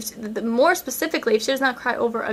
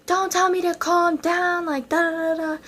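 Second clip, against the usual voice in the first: retold yelling; 6 semitones higher than usual. Girl told to calm down by a friend, high mock yelling. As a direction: act out a tantrum at a high pitch. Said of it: "I start yelling at her".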